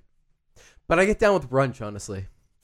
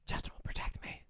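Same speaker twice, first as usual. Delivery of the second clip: barely audible whisper to oneself; retold thought, whispered very softly; whispered aside; 21 dB quieter than usual. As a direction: whisper it small. Retold private thought, voice dropped.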